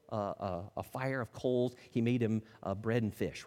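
ordinary talk, nothing marked

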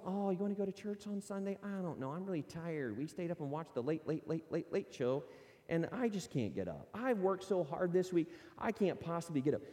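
tired churchgoer excuse. Retold or imitated. Preacher mocking a tired member's excuse, a soft lazy whine. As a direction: mimic a sleepy member begging off Sunday.

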